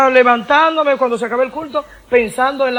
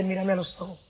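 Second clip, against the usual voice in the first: voz de Dios, baja; 5 semitones lower, 11 dB quieter than usual. Retold or imitated. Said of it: "me dijiste".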